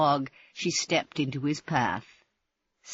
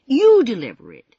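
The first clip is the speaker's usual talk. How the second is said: loud, pushing refusal; insistent; 7 semitones higher, 12 dB louder than usual. Acted. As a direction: push the task back on her. Sandy refuses, pushes it back.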